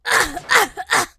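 loud, high frantic cries; panicked. Acted. Waking up in a strange body, panicked. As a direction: yelp and shout in panic.

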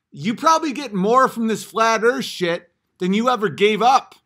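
loud furious boasting rant; angry rant. Real, not acted. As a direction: shout him down, furious. Ranting at commenter.

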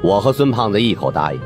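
ordinary delivery, nothing marked

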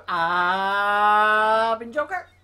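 loud, slow outro announcement; drawn-out sign-off. Real, not acted. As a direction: stretch out the name Joker. Vlog sign-off announcing gamer name.

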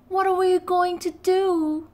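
put-on scared child shouting; frightened shout. A narrator's reading. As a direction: call out over the water. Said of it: "shouted Biff".

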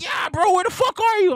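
high, loud panicked yelling over the phone; frantic drunk buddy shouting; friend yelling on phone. Retold or imitated. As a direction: play the angry friend shouting down the line.

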